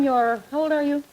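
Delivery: ordinary delivery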